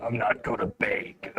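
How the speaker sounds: slow, husky deadpan imitation; lightly voiced cartoon femme fatale; Doctor Girlfriend voice. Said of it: "but like Doctor Girlfriend from, um, Venture Brothers".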